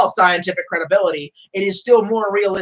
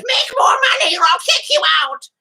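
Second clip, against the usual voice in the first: mock shout; 14 semitones higher than usual. Mama Society threatening eviction, high shouted mock. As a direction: bark it like a nagging landlord. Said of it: "Mama Society comes by and goes".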